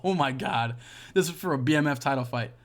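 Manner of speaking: ordinary delivery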